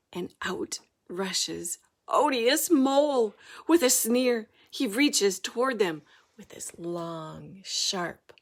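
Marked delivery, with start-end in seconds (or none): none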